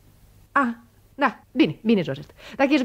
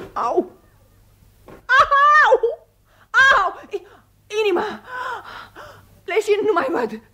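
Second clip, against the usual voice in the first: fainting cries; 12 semitones higher than usual. Acted. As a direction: cry out as she collapses. Faints, crying out.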